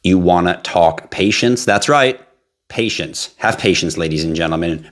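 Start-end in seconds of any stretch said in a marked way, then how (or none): none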